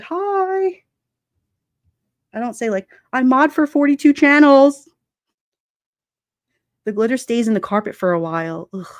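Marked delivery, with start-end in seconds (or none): 0.0-0.8 very high, long-held "hi"; friendly greeting. Acted. Streamer shows how she greets everyone, high-pitched hi. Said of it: "it's just in my nature to be like". 3.1-4.9 loud, high announcement; mock boast. Streamer acts out bragging about modding, loud mock boast.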